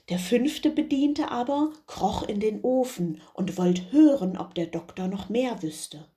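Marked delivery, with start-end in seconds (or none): none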